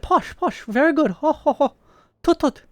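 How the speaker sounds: hammed-up posh British. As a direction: play a silly upper-class British twit. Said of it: "Do I sound British?".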